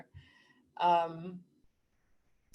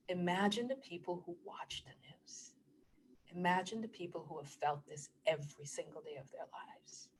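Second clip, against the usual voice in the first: whispered, softer appeal; hushed; 9 dB quieter than usual. Real, not acted. Vlogger asking viewers to imagine daily pain, whispered. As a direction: drop to a whisper to make them imagine. Hushed plea about the news.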